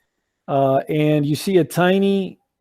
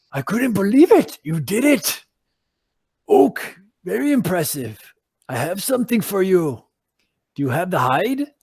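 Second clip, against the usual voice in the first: higher, eager voice; excited druid; 3 semitones higher than usual. Acted. Transformed eagle druid praising the party, eager and higher. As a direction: gush then get down to business. Eagle in human form speaks.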